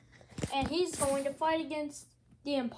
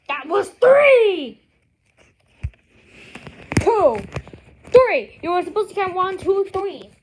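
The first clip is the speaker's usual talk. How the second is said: loud protest; 4 semitones higher, 11 dB louder than usual. Acted. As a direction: shout it at the ref. Protesting the count.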